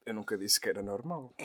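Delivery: ordinary talk, nothing marked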